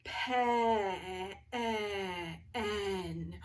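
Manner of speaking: "pe- e- en" with the short eh stretched and repeated; slow sounding-out. Shown as a demonstration. Teacher checking pen for its vowel, slowly sounded out. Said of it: "let's say 'em slow".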